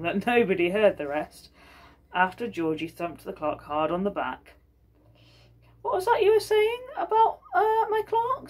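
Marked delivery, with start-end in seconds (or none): 5.8-8.5 much higher, louder sudden alarm; alarmed governor. Acted. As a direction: jump on it suspiciously. Governor alarmed about his clerk.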